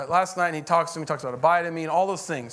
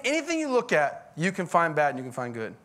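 normal talking voice

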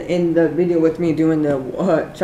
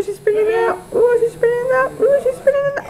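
squealing it higher each time; high squeaky voice. Shown as a demonstration. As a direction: repeat it in a rising squeal.

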